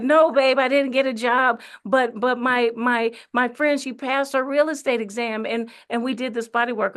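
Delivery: high, loud, excited rush of explanation. Retold or imitated. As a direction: re-enact gushing good news to a sweetheart. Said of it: "I was so excited".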